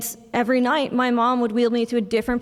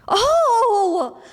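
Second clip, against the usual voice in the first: loud, high, drawn-out exclamation; sudden recognition; 12 semitones higher, 5 dB louder than usual. Retold or imitated. Nurse finally recognizes the patient's mom, loud surprised recognition. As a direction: act out the penny dropping, big and high. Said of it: "And he goes".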